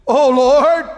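high, loud cry to God; loud pleading cry of Oh Lord; anguished cry. Acted. Voicing prophet's prayer.

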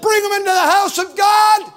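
high, loud rising pulpit shout; fiery altar call; full-force preaching. Acted. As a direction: preach it with fire.

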